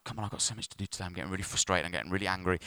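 low, soft grumble to himself; muttered impatience. Retold or imitated. Driver stuck in traffic grumbles to himself, muttered impatience. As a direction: grumble under your breath, mildly retold.

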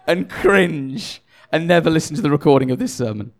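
laughing at his own slip; self-mocking words through laughter; laughing, embarrassed by his own mix-up. Real, not acted. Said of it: "Just admitting it".

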